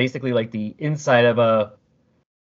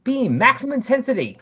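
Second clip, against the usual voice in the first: a higher, urgent commander voice; barked order; 12 semitones higher than usual. Retold or imitated. Leader ordering the squad to set guns to beam, urgent. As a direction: snap out orders to the troops. Retelling a comic, officer orders weapons.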